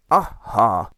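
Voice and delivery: loud triumphant aha; Admiral's cry. Acted. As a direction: bark it out proudly. Admiral's catchphrase.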